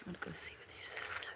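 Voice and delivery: quick hushed whisper. Real, not acted. Owner asking the kitten to join her sister, whisper. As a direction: whisper it fast and close.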